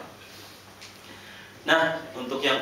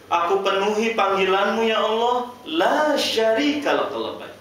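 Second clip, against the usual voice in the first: fervent vow; 12 semitones higher, 7 dB louder than usual. Real, not acted. Preacher voicing the pilgrims' vow to Allah, high and loud. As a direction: proclaim the vow with full force.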